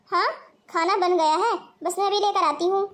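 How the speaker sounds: ordinary delivery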